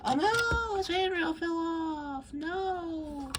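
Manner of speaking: very high, drawn-out cartoon wail; hammed-up high-pitched lament; mock wail. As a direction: cry out in exaggerated despair, stretching each word. Mock dismay at toy breaking.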